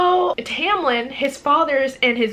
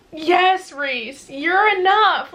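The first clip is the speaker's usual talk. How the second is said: emphatic; 6 semitones higher, much slower than usual. Real, not acted. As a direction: insist it with all your heart. Gushing at a character.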